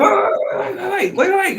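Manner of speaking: very high, loud frantic pleading; mock panic. Goku begs Broly not to snap, mock panic. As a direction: plead in comic alarm.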